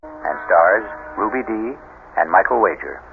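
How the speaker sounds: steady announcer voice for credits and sponsors; host announcer read. A narrator's reading. Radio host introducing the drama and sponsors, announcer style. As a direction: present the show like a radio host.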